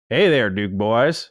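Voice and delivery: put-on slow Southern drawl on the greeting, Southern accent; thick Southern accent. Podcast host reading a listener's greeting, mock Southern accent. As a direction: stretch it out like a Southern good ol' boy, Southern accent. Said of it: "be sure to say this in a thick Southern accent".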